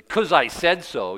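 slow, loud, curt brush-off; bossy dismissal. Retold or imitated. Master brushing off a servant's why, loud and slow. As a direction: slap the question down like a boss. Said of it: "you don't say".

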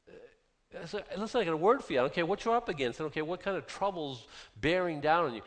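normal talking voice

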